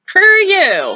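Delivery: very high, mocking brush-off; mock defiant retort. Principal to neighbor begging at the window, mock defiant retort.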